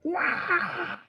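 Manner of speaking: high, drawn-out mock scream; imitated scream. Pastor imitating the possessed person being released, high mock scream. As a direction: imitate a sudden high cry without much volume. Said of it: "Tiba-tiba dia teriak".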